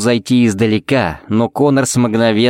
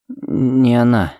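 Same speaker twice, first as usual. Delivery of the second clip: slow, halting words, near tears; trembling lips; much slower than usual. Acted. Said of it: "губы его задрожали". (медленно, с дрожью, почти плача)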